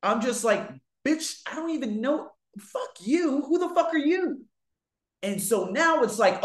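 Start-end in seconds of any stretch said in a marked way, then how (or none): 1.0-4.5 higher, snapping back in a retold fury; hammed-up angry rant, pitched up; mock furious outburst. To the woman who accused him, a mock furious outburst.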